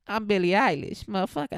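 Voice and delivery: hammed-up [name] voice; [name] impression. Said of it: "She talks like [name]".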